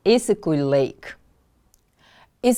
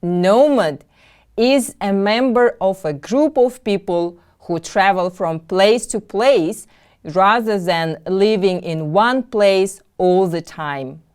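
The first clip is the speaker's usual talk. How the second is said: ordinary delivery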